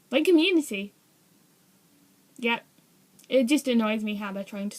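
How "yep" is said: ordinary delivery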